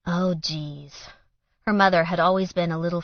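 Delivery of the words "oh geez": plain reading voice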